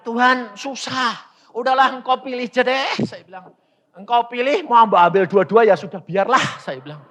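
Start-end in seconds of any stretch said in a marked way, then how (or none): 0.1-3.0 high, loud giving-up tone; worked-up surrender to God; exasperated acted plea. 3.9-6.6 loud, high and hurried; acted fed-up plea; exasperated surrender. Acted giving up before God.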